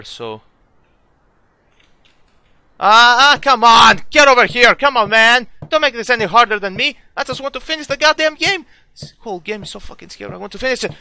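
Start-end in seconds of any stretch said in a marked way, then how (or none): 2.9-8.7 loud exasperated shouting at the enemy; angry loud calls; frustrated yelling. Real, not acted. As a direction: yell at it to come back.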